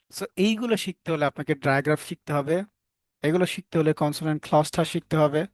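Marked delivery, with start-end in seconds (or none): none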